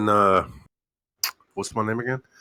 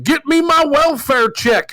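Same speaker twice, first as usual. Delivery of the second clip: much higher, slow, bossy yell; mock demanding yell. Host mocking a lazy man ordering his family, higher bossy yell. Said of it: "he'll be like".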